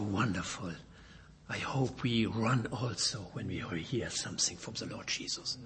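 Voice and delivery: ordinary delivery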